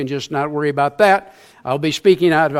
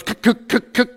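imitated engine sputter cutting out; 5 semitones higher than usual. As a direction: sputter like a dying outboard. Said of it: "my engine goes".

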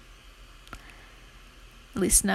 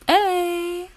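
high, drawn-out "ey"; friend's catchphrase; 8 semitones higher, 4 dB louder than usual. Retold or imitated. Blurting out a friend's catchphrase while studying, high drawn-out call. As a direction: toss out a high catchphrase at the end. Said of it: "A close friend of mine always says".

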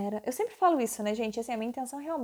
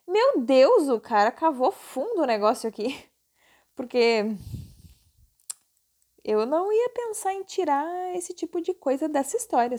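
plain reading voice

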